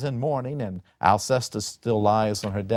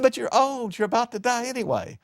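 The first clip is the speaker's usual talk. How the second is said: indignant son; 13 semitones higher than usual. Acted. Son arguing with his father.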